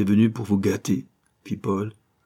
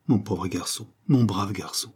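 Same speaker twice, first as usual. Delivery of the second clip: usual speaking voice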